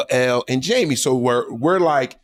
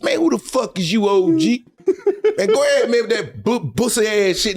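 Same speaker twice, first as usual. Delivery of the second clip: mocking young player, raised voice; 6 semitones higher than usual. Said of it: "He said".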